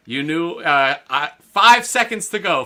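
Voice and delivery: ordinary talk, nothing marked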